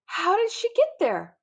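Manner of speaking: can't believe your eyes; stammered amazement; astonished. A narrator's reading. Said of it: "I ask".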